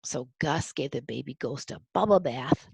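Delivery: plain reading voice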